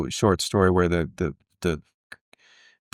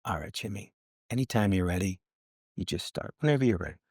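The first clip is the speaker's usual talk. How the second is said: Clint Eastwood, really quiet; 8 dB quieter than usual. Retold or imitated. Director quietly cueing his actor instead of yelling action, hushed imitation. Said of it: "He's really quiet, and he goes".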